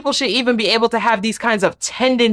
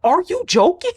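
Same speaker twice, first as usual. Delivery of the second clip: incredulous; 9 semitones higher than usual. Retold or imitated. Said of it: "I'm like".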